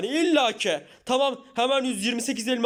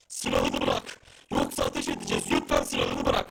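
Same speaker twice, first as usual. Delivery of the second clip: deep guard voice; 4 dB quieter than usual. Acted. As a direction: sound like a machine-voiced gatekeeper. Guards order to drop weapon.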